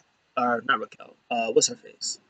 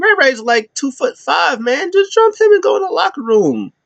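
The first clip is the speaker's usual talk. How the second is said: much higher joking voice; high teasing put-on voice, egging them on; mocking advice; 14 semitones higher than usual. Vlogger telling New Day to jump Rey, high joking voice. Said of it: "I was like".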